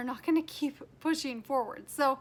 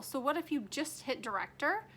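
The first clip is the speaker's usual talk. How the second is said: ordinary talk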